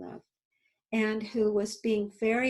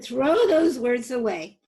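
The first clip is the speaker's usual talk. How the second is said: firm command; 2 semitones higher, 8 dB louder than usual. Retold or imitated. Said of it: "I said".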